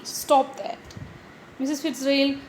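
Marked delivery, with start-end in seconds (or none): none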